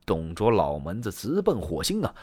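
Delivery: plain reading voice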